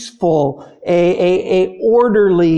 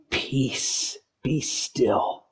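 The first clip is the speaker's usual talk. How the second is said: soft, low, breathy command to the storm; calm hush; 7 semitones lower, 10 dB quieter, much slower than usual. Acted. Jesus stilling the storm with a quiet hush. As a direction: hush the sea gently but with authority. Jesus rebuking the wind and sea.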